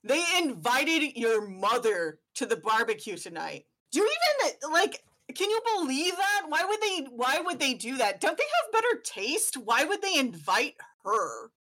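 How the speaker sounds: high-pitched, gossipy outraged father; indignant dad. Acted. Dad ranting to his son about the barbecue invite, outraged. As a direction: huff about the neighbours' betrayal.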